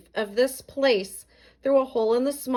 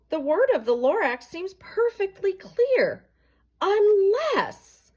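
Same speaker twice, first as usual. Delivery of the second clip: higher, louder, urgent realisation; earnest revelation; 11 semitones higher, 3 dB louder than usual. A narrator's reading. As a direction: reveal it with rising urgency. Once-ler revelation.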